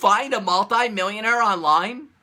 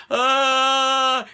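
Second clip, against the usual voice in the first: freaked-out yelp; 6 semitones higher than usual. Retold or imitated. Mocking a nervous beginner freaking out, high yelp. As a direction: yelp like you're panicking.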